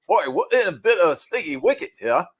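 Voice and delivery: mock-British toff, playing it for laughs; jokey put-on British accent. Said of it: "brought out my British accent and said".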